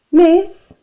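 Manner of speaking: high, loud call. A narrator's reading. Dennis calls to his teacher across the way, calling out. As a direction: call to get the teacher's attention. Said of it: "he called out".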